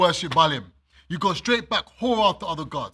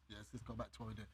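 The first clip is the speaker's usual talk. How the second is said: quiet mock aside; 8 semitones lower, 23 dB quieter, much faster than usual. Voicing the people, very quiet.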